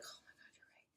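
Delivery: whispered retold gasp of agreement; awed, barely voiced reply; hushed realization. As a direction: drop to a tiny whisper. Retold overwhelmed reply to mom.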